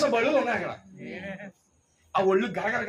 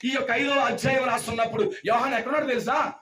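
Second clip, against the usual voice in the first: raised, driving preacher's voice; forceful preaching; 6 semitones higher than usual. Real, not acted. Preacher describing John among scorpions and serpents, forceful preaching. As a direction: build the peril phrase by phrase.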